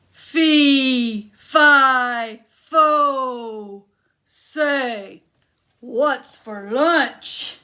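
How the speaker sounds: loud, slow booming chant; big giant stomping in for lunch. Acted. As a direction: boom each syllable like a giant.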